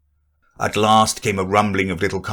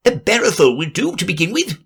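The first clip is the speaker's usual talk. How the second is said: rabbit voice; 10 semitones higher, faster than usual. Acted. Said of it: "Alice heard the rabbit say".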